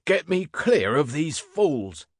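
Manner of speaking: high, slow, exasperated cry. A narrator's reading. Handcuffed inspector appeals to his constable, crying out. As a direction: demand rescue from idiots. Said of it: "cried the inspector".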